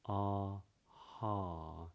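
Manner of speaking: ordinary talk, nothing marked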